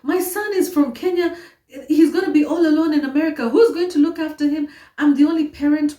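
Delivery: high, urgent mother bargaining for her son; retold pleading. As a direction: beg fast and high for your child's sake. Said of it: "I said".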